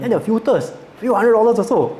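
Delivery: shocked disbelief. Real, not acted. Shock at the filter prices.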